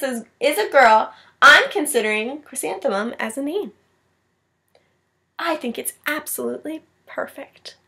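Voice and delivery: put-on expressive storybook read-aloud for kids; reading to children. A narrator's reading. Teacher reading a picture book to her class, expressive read-aloud. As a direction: read it like story time, voicing each child.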